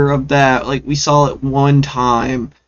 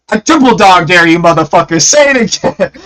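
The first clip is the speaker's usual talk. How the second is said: higher, louder mocking dare; taunting dare; 6 semitones higher, 5 dB louder than usual. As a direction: goad him like a schoolyard bully. Hammond taunting, raised voice.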